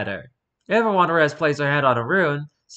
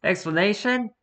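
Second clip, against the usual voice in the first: 1 sarcastic question; 8 semitones higher than usual. Real, not acted. Mocking the article's missing explanation.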